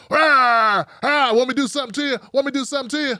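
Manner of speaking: loud, gruff macho growl, barking at passersby; hammed-up aggressive tough guy with an attitude; tough-guy sergeant. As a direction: puff up like a bullying neighborhood sergeant. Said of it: "a cock diesel sergeant with an attitude walking around going".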